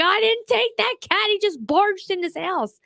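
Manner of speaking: high-pitched defensive protest, slowing at the end. Retold or imitated. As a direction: protest your innocence to the husband. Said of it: "I was like".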